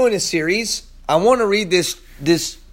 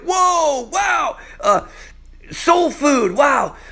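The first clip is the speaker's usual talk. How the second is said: higher, shouted wows; amazed diner; 8 semitones higher, much slower than usual. Retold or imitated. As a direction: exclaim like tasting new food. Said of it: "I was like".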